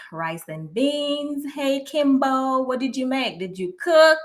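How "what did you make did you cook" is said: ordinary talk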